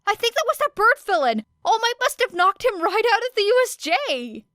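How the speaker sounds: high, loud excited student; a kid shouting in awe; excited yell. A narrator's reading. Excited student shouting about villain.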